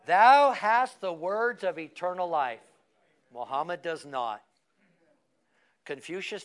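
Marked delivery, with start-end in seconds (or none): 0.1-2.6 slow, loud, measured scripture line; slow recitation. Shown as a demonstration. Preacher leading the congregation in a slow, loud recitation. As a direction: lead the church through it word by word. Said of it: "Say it with me".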